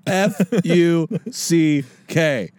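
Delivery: slow letter by letter spelling. Shown as a demonstration. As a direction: spell each letter slowly and clearly. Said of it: "sound out the letters".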